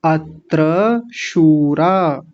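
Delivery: अत्र शूरा very slow, oo stretched; drawn-out long vowels. Shown as a demonstration. Teacher joins the two words, slow stretched अत्र शूरा. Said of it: "थोड़ा सा लंबा खींचेंगे".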